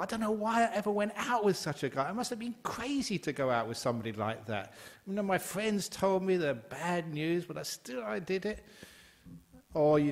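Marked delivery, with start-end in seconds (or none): 0.0-8.6 higher, exasperated rant about the ex; bitter blaming. Retold or imitated. Monk mimicking a divorcee blaming her ex, exasperated. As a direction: play someone fed up with themselves for dating him.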